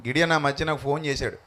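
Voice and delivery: normal talking voice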